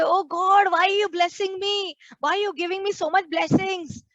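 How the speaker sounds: lightly acted surprise at too many blessings; mock thankful prayer. Preacher imitates a believer questioning God, mock puzzled. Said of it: "We don't say".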